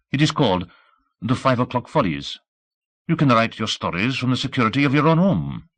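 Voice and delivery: put-on high-pitched, insistent French-accented concierge; thick French accent. A narrator's reading. Concierge urging the newsman to stay safe, animated French accent. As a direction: urge him in animated French-accented English. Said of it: "with a thick French accent".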